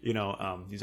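ordinary talk

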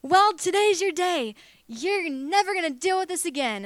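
high, loud, cheery proclamation; imitating someone joyfully overconfident; beaming confidence. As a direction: announce it brightly, with a big smile. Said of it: "like, smiling and looking, and he's like".

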